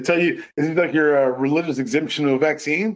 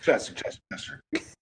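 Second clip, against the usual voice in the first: hushed scolding; 6 semitones lower, 9 dB quieter, faster than usual. Acted. Calling friend to order.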